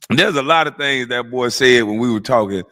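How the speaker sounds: ordinary talk, nothing marked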